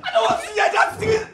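high wailing sobs; sobbing. Acted. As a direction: wail it through tears. Sobbing refusal of the truth.

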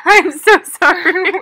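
high giggly apology; laughing. Real, not acted.

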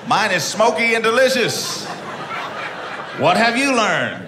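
high, slow, pompous imitation; mock grandeur. The comic mocking the costumed guy: high pompous mimicry. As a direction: ape his grand tone right back.

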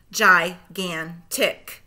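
slow, split into syllables; segmented syllables. Shown as a demonstration. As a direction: chop Gigantic into its syllables, slowly. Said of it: "say the syllables in segments".

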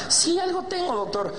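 high-pitched camp caricature; mock-effeminate whine; effeminate patient. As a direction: complain to the doctor in a high, fussy voice. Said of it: "llega un putito".

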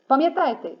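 ordinary talk, nothing marked

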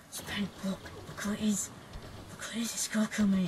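hushed whisper so Goku won't hear. Retold or imitated. Friend tipping him off about Goku nearby, hushed whisper. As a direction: breathe it secretly to your friend.

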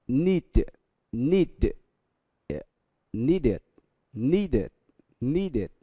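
"needed" with an added id syllable. Shown as a demonstration. Teacher drilling "needed" for the class, id ending. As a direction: drill "needed", soft final id.

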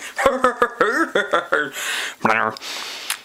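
laughing. Real, not acted. Laughing at own impression.

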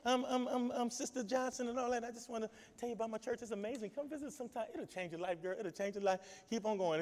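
lightly voiced friendly invite, quiet; soft, easy pitch to a stranger eating; church member inviting. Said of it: "They eating and say".

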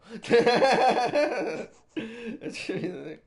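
big burst of laughter; loud laugh. Real, not acted. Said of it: "Shut the fuck up, [name]".

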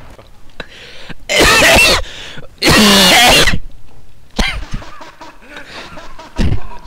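choking fit of laughter; coughing laugh. Real, not acted. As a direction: laugh until you choke. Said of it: "nearly died then".

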